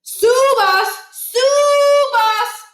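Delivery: high, loud singsong cow call. A narrator's reading. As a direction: cry out to distant cows. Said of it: "Niles cried out again".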